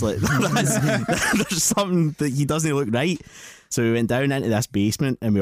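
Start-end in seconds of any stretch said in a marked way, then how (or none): 0.0-3.2 laughing through the words, voice up; cracking up while talking about him; giggling at the creepy man. Real, not acted.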